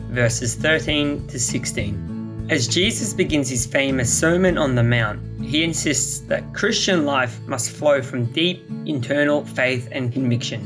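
ordinary talk, nothing marked

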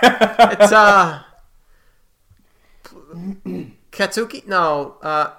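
high, hesitant guessing; Apollo, guessing. Acted.

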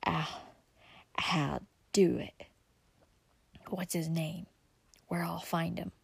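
breathy, rasping whisper from the outlaw; a greedy outlaw's hoarse whisper. A narrator's reading. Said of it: "he answered in a hoarse whisper".

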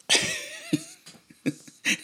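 bursts into a high laugh; fond high laugh; real laugh. Real, not acted. Laughing about son's request.

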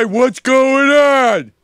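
high, loud, slow mocking impaired voice; mock-impaired voice, dragged and strained; brain-injured mocking voice. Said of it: "Dude, just embrace it".

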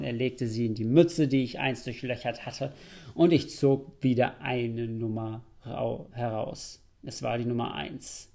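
ordinary delivery

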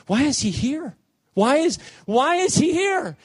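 high, rising cry of dismay; alarmed questioning. Retold or imitated. Man of Gadarenes sees who has come, rising alarm.

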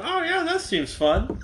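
high, slow drawling wrestling manager; Bobby Heenan impression. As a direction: ham up a high sleazy manager voice. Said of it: "Old Bobby Heenan reference there for you".

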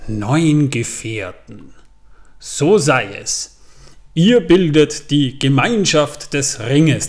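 very slow, solemn elven lord; weighty voice. Retold or imitated. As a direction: pronounce it like a grave decree. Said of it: "in bedeutungsschwerer Stimme".

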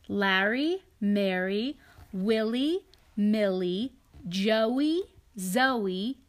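storybook read-aloud, lightly lilting; reading to children. A narrator's reading. Parent reading a picture book to a child, gentle storytelling. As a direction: read it to a little one beside you.

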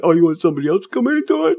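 snippy nurse, hammed-up; mocking nurse. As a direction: parrot her offended tone. Mimicking offended nurse.

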